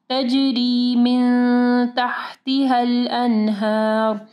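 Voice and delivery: slow chanted तजरी मिन तहतिहल अनहार; ikhfa ghunnah. Shown as a demonstration. Teacher recites for students, slow tajweed. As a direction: hold the humming n of मिन, long vowel in अनहार. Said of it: "यहाँ पे भी इख्फा गुन्ना होगा".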